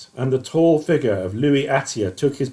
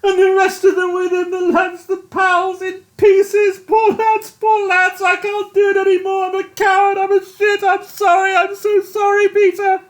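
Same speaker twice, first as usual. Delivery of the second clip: high, loud wailing guilt; a shell-shocked captain falling apart; distraught crying confession; sobbing breakdown; 4 dB louder than usual. A narrator's reading.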